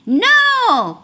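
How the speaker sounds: high, loud scream of no; terrified cry. A narrator's reading. Witch refuses the dragon, shrieking high and loud. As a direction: shriek it in panic. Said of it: "cried the witch".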